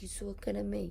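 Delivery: soft, lightly voiced Italian-American wiseguy line; a hint of Italian-American menace, kept quiet; mock Italian-American tough guy. Host retells Billy Crystal's Italian-American impression, quietly and lightly. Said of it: "trying to do, like, the Italian vernacular".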